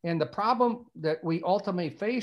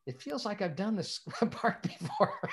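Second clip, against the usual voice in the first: words breaking up into laughter; higher pitch as the laugh takes over; laughing retelling; 5 semitones higher, 5 dB quieter than usual. Retold or imitated. Storyteller retells an actor's reaction to the script, laughing.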